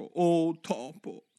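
"Oh topo" drawn out very slowly, "Oh" held long; formal address. Acted. Alice tries the proper way to hail a mouse, slow and ceremonial. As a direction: stretch "Oh topo" like a solemn salutation. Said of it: "il modo più appropriato di rivolgersi a un topo".

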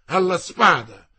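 loud, ringing, insisting on alla; repeated challenge. Acted. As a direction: hammer the challenge home. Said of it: "voce vibrante e beffarda".

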